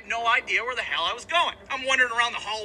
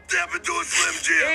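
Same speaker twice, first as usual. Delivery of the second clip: raspy wrestler growl, higher; Macho Man impression; 6 semitones higher than usual. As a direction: shout it like a hyped wrestler. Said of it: "not Macho Man Randy Savage".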